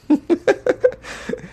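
high unvoiced strained giggle; breathy forced laugh. Host mocking a forced Russian smile with a strained giggle. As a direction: squeeze out a fake laugh. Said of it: "my impression of Russian smile".

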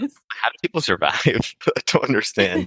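slow, giggly bafflement; laughing in disbelief. Real, not acted. Guest marvels at island farmers, laughing slowly. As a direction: laugh through the words, amazed.

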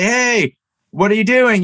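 a mild retold holler, pitch lifted; mock yell down. Man on yacht calls to fisherman below, mild mock yell. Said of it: "person from the yacht yells down".

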